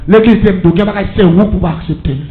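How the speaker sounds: prêche crié, plein de ferveur; proclame-le à toute la salle; sermon prêché à pleine voix. Acted. (prédication criée avec ferveur)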